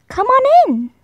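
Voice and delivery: high, bright coaxing call; hippo family inviting. Acted. Hippo family calling him in.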